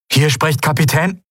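high, urgent captain on the radio; high-pitched captain's emergency call; distress caller. A narrator's reading. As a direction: call out high over heavy static. Stricken captain's broken-up distress call.

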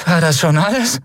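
defiant taunt. Acted.

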